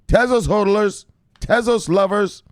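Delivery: high, loud shout-out; cheering for the fans in a loud, high voice; hype shout-out. Real, not acted. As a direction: celebrate the fans loudly. Shouting out Tezos holders.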